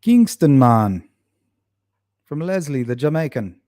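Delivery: hammed-up attempt at a Jamaican accent; bad Jamaican accent. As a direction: put on a poor Jamaican accent. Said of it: "that wasn't a very good Jamaican accent".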